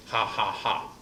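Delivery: slow, low, spoken "ha ha ha"; mock villain laugh. Publisher knows it's false and prints it anyway, mock villain laugh. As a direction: fake evil chuckle, each ha separate.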